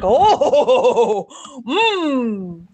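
normal talking voice

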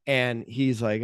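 normal talking voice

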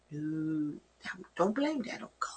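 softly whispered correction. Real, not acted. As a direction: lean in and whisper it. Gentle correction to congregation.

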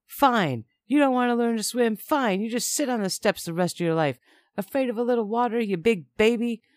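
loud, high-pitched angry taunting; angry snap. A narrator's reading. Man to child refusing swim lesson, angry snapping. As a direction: snap and yell it mockingly. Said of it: "And he snapped".

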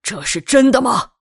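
angry demand. Acted. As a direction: confront them with a furious shout. Father confronts wives, glaring.

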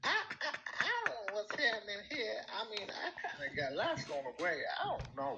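high-pitched put-on American accent. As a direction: play a comic, flustered American accent. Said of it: "that's not his normal accent".

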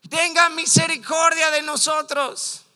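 loud, high plea for mercy; crying out. Retold or imitated. Preacher acts two blind men calling to passing Jesus, shouting desperately. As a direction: shout out like blind beggars by the road. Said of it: "empezaron a gritar".

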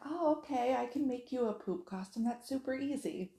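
put-on high, upbeat talk to a toddler; acted agreeable mom. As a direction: re-enact an eager, accommodating mother. Mother reassuring toddler in story.

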